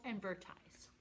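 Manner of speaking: whispering. Acted. Whispered word ending list.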